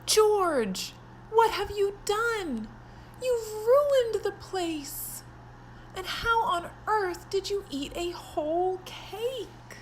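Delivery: aghast at the wrecked house; shocked Harris. Acted. Harris scolding George over the mess, shocked.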